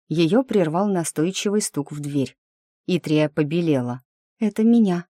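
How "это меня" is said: high, urgent panic; frightened, as if about to be caught; panicked whisper. A narrator's reading. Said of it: "прошептала она одними губами и в панике".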